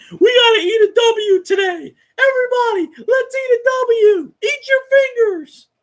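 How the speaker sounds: exaggerated squeaky locker-room chant; mocking Winston. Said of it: "He's like".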